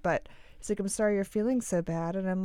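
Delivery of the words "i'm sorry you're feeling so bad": normal talking voice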